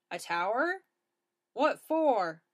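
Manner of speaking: loud, suspicious officer; questioning officer. A narrator's reading. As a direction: demand an explanation.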